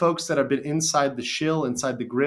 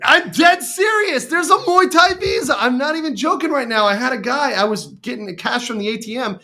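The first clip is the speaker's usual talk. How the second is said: loud, high, excited protest that it's true; real worked-up insistence, pitch way up; emphatic insisting; 10 semitones higher, 8 dB louder than usual. Real, not acted. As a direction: insist at the top of your voice, easing slightly into the story. Insists he is not joking.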